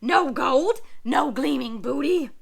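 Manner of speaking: loud, bitter pirate complaint; frustrated pirate. Acted. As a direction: let the disappointment burst out. Pirate disappointed.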